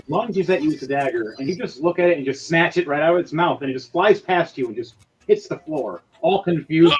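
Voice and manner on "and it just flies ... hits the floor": ordinary delivery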